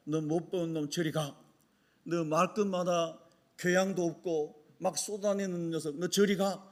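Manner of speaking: low, quiet imagined rebuff; dismissive rejection. Retold or imitated. Jesus imagined pushing uneducated John away, low dismissive rebuff. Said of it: "이렇게 주님이 저를 뿌리치지도 않았습니다".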